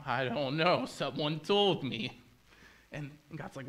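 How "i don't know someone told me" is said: drawn-out, sheepish dodge; evasive stalling. Acted. Adam and Eve answering God, slow evasive stalling. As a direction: drag the words out as you dodge the question. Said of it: "they go".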